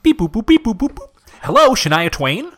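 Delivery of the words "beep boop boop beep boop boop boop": high beep-boop dialing noises; phone dialing beeps. Pretend. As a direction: mimic keypad tones playfully.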